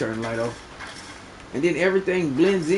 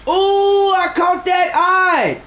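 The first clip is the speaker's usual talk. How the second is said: high, loud whoop, slow on the words; excited shout; 9 semitones higher, 5 dB louder, much slower than usual. Real, not acted. Streamer hooking a fish on stream, loud excited shout. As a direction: yell out the catch in triumph.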